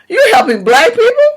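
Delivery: high, pearl-clutching mock gasp of outrage. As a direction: imitate an offended lady clutching her pearls.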